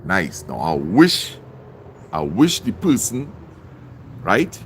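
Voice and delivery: ordinary delivery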